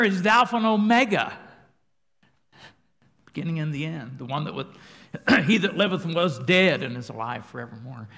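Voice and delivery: loud, high fervent preaching. Real, not acted. Preacher exclaiming to congregation about Mary, loud and fervent. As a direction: preach it with full fire.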